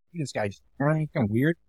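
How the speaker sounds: slow hushed inner thought; muttered aside. Retold or imitated. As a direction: say it quietly to yourself, slowly. Private thought at urinal.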